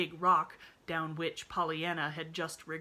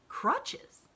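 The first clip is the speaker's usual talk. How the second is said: astonished; 14 semitones higher, 7 dB louder than usual. A narrator's reading. Nancy astonished.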